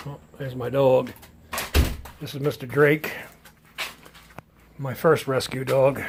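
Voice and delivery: usual speaking voice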